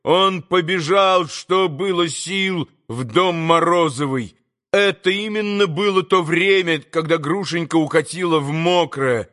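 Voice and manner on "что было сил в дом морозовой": ordinary delivery, nothing marked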